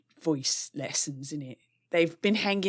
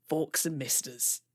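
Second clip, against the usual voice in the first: ordinary talk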